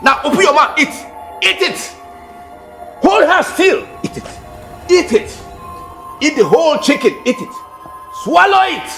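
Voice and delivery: put-on a cruel mistress punishing a thieving girl; furious scolding. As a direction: force her to eat, enraged. Woman punishing thief, forcing food.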